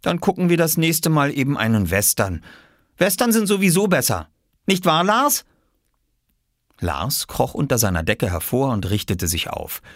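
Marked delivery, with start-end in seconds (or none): 0.0-5.4 put-on high-pitched boy's voice; high, eager childlike chatter; boy character, upbeat. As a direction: suggest it brightly as a high-voiced kid. Boy figure in story.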